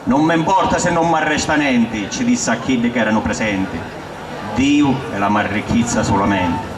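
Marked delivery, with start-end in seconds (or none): none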